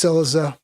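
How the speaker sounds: "Cillizza" stressed on the first syllable, CIL-lizza. Shown as a demonstration. Host testing the name aloud, first syllable stressed. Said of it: "where you stress the accent".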